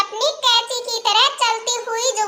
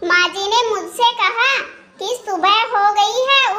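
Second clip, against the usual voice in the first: usual speaking voice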